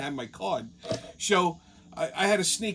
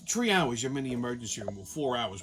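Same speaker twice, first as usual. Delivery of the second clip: ordinary talk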